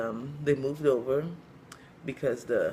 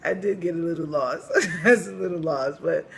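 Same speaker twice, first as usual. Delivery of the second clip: higher voice broken by laughter; real laughing; 5 semitones higher, 7 dB louder than usual. Real, not acted. Storyteller admitting getting lost, laughing. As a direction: talk through the leftover laugh.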